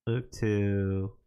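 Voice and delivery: low, mellow stoned voice; lazy and baked; stoner. Real, not acted. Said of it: "I'm, like, baked right now".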